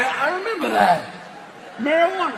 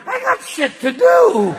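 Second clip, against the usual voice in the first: bored impatient penis; 9 semitones higher, 5 dB louder than usual. Acted. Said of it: "even my penis is going".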